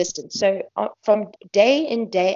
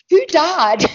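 mock alarmed question; 10 semitones higher, 7 dB louder than usual. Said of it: "people saying".

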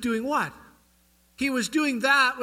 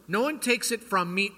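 ordinary delivery

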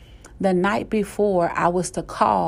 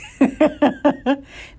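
high staccato laughter; amused cackle; 6 semitones higher than usual. Real, not acted. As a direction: burst out laughing at yourself. Laughs after acting reply.

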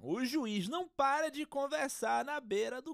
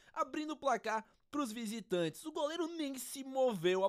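normal talking voice

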